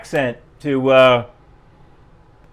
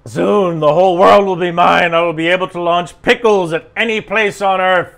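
a hint of a world-conquering villain; gloating villain; 2 semitones higher, 4 dB louder than usual. Narrating a villain's dream of world domination, mock-gloating. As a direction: play the mock villain announcing a scheme.

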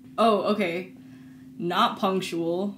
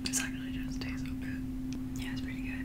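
whispering; 4 semitones higher, 10 dB quieter, faster than usual. Real, not acted. Whispering while eating together.

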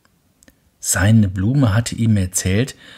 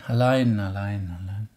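repeats fading to silence; fading echo; 5 dB quieter than usual. A narrator's reading. Echo answers the lonely prince, fading. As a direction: let it die away. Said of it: "antwortete das Echo".

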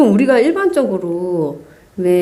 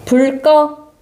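raised, higher command to a speaker; talking to voice assistant; 6 semitones higher, much slower than usual. Shown as a demonstration. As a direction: give the device a clear order. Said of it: "큰 목소리로 해주세요". (스마트 스피커에 크게 명령하는 목소리)

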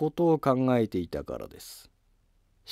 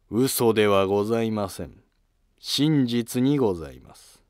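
ordinary delivery

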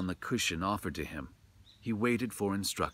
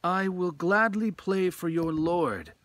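much higher, louder willing reply; eager Hoichi; 10 semitones higher, 6 dB louder than usual. A narrator's reading. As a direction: accept the invitation gladly. Hoichi answers the samurai.

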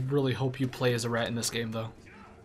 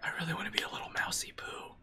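whispering; 5 semitones higher, 8 dB quieter than usual. Real, not acted. Wishing to be the rat.

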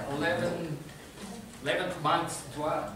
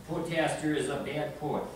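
normal talking voice

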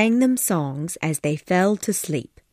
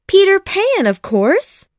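put-on high, louder little girl's voice; emphatic young Wendy; 13 semitones higher, 5 dB louder than usual. A narrator's reading. Wendy answers her mother's question, high and emphatic. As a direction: answer as an excited child, starting very high.

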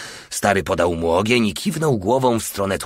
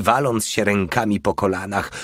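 ordinary delivery, nothing marked

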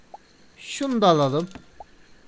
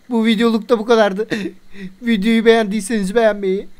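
higher, louder sign-off pitch to viewers; hyped-up channel outro asking for likes; youtuber outro; 4 semitones higher, 5 dB louder than usual. Acted. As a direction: wrap up the video with a bright push to subscribe.

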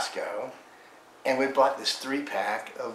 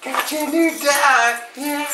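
much higher and louder pet talk; excited squeal; 8 dB louder than usual. Real, not acted. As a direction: squeal it with delight for the dog. Giving dog the toy.

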